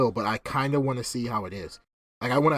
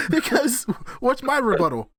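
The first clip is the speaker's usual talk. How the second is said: high, loud, laughing through the words; squeaky laughing protest; laughing indignation; 13 semitones higher, 4 dB louder than usual. Real, not acted. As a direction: crack up while complaining. Laughing over own imagined lobby scene.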